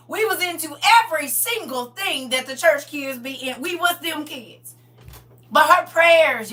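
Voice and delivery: loud, high, fervent preaching; preaching at full force. Acted. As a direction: preach it out to the congregation.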